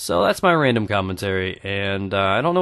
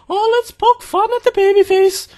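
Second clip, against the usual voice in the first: high sing-song mock of relatives teasing; high teasing imitation, a bit louder; mocking family voice; 5 dB louder than usual. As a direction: squeak it out like someone poking fun at a kid. Family mocking his baby face.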